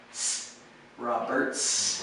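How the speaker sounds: Roberts with a clear hissed S at the end; Roberts, final s clearly audible; final s sound. Shown as a demonstration. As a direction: finish Roberts on a crisp S. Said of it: "always put that S sound on the end".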